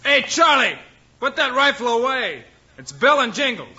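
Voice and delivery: high, carried call up to the house. Acted. Marshal calls up to the rancher to stop shooting. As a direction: call across the yard so he hears.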